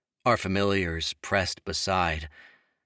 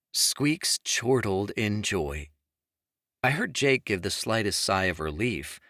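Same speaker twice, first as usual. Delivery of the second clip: plain reading voice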